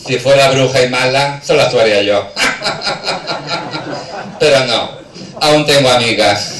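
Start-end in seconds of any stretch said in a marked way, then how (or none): 2.3-4.3 risa aguda a golpes; carcajada. Real, not acted. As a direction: carcajada larga y entrecortada. Se ríe de su propia broma. (risotada aguda tras su propia broma)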